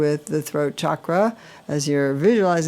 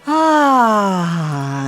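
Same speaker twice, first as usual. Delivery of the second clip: loud, higher open-jaw sigh; deep sighing ah; 4 semitones higher, 5 dB louder than usual. Shown as a demonstration. Guide demonstrates the releasing sigh, a loud open ah. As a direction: let a full ah pour out. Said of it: "So that deep sigh".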